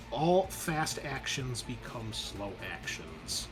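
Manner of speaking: usual speaking voice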